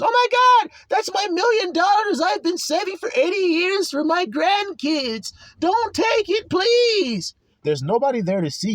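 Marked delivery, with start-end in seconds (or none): 0.0-7.3 put-on much higher, pleading old woman's voice; pleading old lady. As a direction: beg like a frail old lady protecting her savings. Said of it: "this old-ass lady comes and says".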